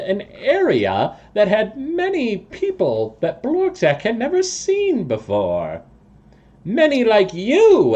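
high, halting toad-man voice; storytelling toad creature. Acted. Toad person reports sewer sighting to party, high and grand.

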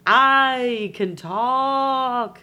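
very slow, loud drawn-out words; mystery phone voice. Acted. Mystery caller phoning again the next night, loud eerie drawl. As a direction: boom it out, stretching every word. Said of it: "this voice is like".

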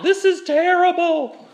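high, loud mock wail; crying out. People of Israel complaining without turning to God, hammed-up crying out. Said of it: "They were just crying out".

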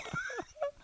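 high laugh. Real, not acted. Laughing at own story.

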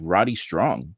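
normal talking voice

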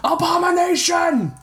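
high, far-off angry crowd yell; a militiaman shouting at Alphonse. A narrator's reading. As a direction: hurl the insult from the charging mob. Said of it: "clear shouts of angry voices".

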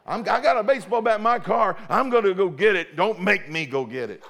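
mock threat. Bully threatens with baseball bat.

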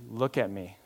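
low, hushed, tender appeal; compassionate. Acted. Dad kneeling to his young son, compassionate. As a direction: softly draw the child in with compassion. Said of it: "In compassion".

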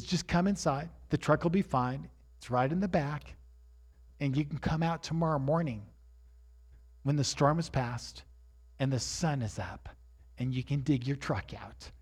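soft, low reassurance, settling downward; imitating a wife's calm, quiet persuasion; gentle coaxing. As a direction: talk him softly into coming inside. Voices wife coaxing him indoors.

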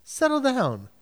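short, separate phrases to calm a group; acted cutting through. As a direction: cut through the panic and settle them. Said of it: "just cut through and go".

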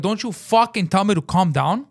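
high, slow angry warning; retold screaming. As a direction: hammer out the retort. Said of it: "Now I'm screaming".

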